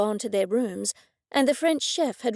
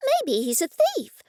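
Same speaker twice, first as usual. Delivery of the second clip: slow, higher mock-cheerful; 6 semitones higher, 3 dB louder than usual. Cressida teasing about the chef, cheerfully higher. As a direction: toss it off brightly and higher. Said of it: "said cheerfully".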